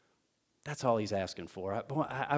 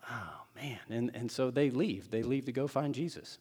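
normal talking voice

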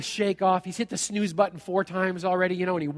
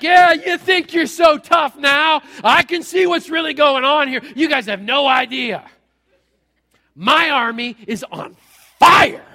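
talking smack; 6 semitones higher, 10 dB louder than usual. Retold or imitated. Servant taunting the Aramean army, loud and high. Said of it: "start talking smack right there".

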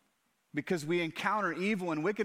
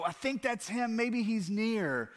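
a hint of a doubtful searcher, higher; unsure seeker; 6 semitones higher than usual. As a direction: voice someone guessing where God is, lightly. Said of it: "strain to go".

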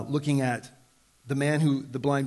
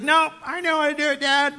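put-on much higher, louder child's voice; stubborn kid; 12 semitones higher, 5 dB louder than usual. Father imitating his child refusing help, high and whiny. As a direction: play the know-it-all kid. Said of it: "when they're just like".

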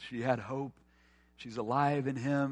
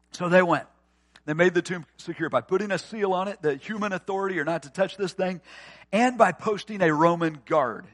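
loud, high-pitched sermon delivery; fiery pulpit reading; preaching at full force; 4 semitones higher, 8 dB louder than usual. Acted. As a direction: drive the scripture home. Preaching the burial text.

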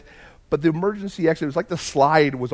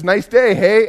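chatty seatmate; 5 semitones higher, 7 dB louder, slower than usual. Retold or imitated. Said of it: "and he says".

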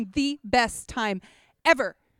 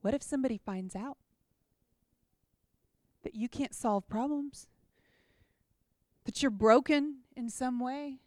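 lightly voiced fear, hushed and uneasy; 6 dB quieter than usual. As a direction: let fear plant the doubt softly. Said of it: "fear comes along and says".

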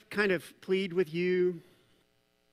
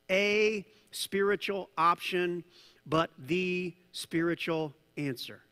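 slow, with "a" and "the" set apart and stressed; stress on articles; slower than usual. Real, not acted. Preacher contrasting an option with the only answer, stressed articles. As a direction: lean on "a", then on "the", slowly.

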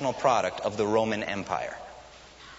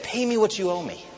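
sharp, higher demand for payment; 7 semitones higher, 3 dB louder than usual. Acted. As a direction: bark the demand at the choking debtor. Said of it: "grabbed him by the throat".

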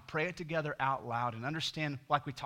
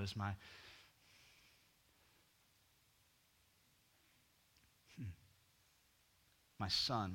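tender voice of God; 5 semitones lower, 8 dB quieter than usual. Acted. God voiced speaking to believers.

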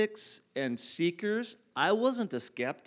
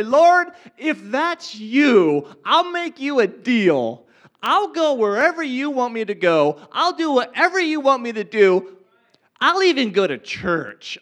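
loud, higher, defiant challenge; angry shout to God; 5 semitones higher, 9 dB louder than usual. Retold or imitated. Angry young man bargaining with God, shouting to the sky. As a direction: fist in the air, demanding a deal.

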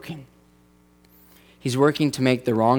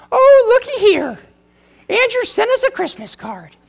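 very high, loud, hammed-up North Dakota accent; North Dakota relative voice; 5 dB louder than usual. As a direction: squeal it like a folksy North Dakota relative reading a card. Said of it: "turned out to be from North Dakota".

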